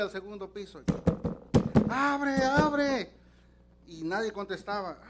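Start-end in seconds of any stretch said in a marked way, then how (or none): 1.9-3.1 high, loud demand to open up; drunk yelling. Retold or imitated. Drunk husband yells at his wife to open the door, loud and high. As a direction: bellow at the locked door.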